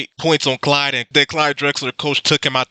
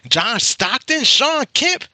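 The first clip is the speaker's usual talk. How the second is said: excited disbelief; 10 semitones higher than usual. Real, not acted. Podcast host marvelling at Bird trash-talking everyone, excited and high. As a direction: voice jumps way up, thrilled. Amazed at Bird's trash talk.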